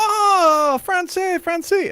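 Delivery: high-pitched, delighted cry; mock-thrilled French exclamation; excited French guy. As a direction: greet a fellow countryman with gushing delight. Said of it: "and the guy goes".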